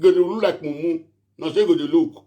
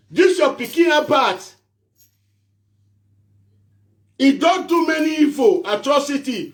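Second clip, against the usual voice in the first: denouncing shout; 7 semitones higher than usual. Real, not acted. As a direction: cry out the accusation to the governor. Addressing governor, denouncing Abat.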